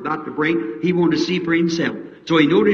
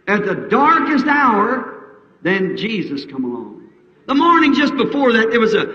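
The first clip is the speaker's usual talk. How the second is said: normal talking voice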